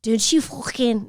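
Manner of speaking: slow, clumsy attempt at a Scouse accent; a first go at a Liverpool Scouse accent. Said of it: "Go for it and try".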